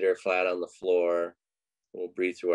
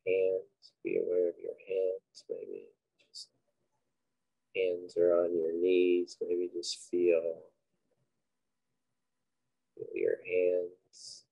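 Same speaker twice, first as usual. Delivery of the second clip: unhurried, quiet cues for breath and body; guided meditation; 3 dB quieter than usual. Acted. Leading listeners through breathing and a body scan, guided meditation.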